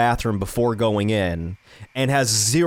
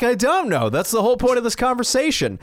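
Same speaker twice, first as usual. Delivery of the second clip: high-pitched exasperated comeback; retold exasperation; 11 semitones higher than usual. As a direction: act out being baffled, voice raised high. Retold bewildered quarrel with sleepy girlfriend.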